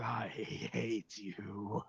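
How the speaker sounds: very drawn-out, grudging words; Duboc. Acted. Captive forced to tell the truth, slowly dragged out. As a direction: drag each word out unwillingly. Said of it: "Duboc failed, and he goes".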